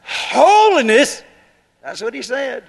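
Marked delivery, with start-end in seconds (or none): 0.0-1.2 high, loud, slowly stretched incredulous question; hammed-up shocked "Holiness?"; mock disbelief. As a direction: echo the word as if it's absurd. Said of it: "Somebody said".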